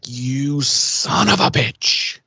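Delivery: slow, drawn-out imitated grudge; mock accusation. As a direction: drag out the insult like a cartoon villain. Characters spotting a rival.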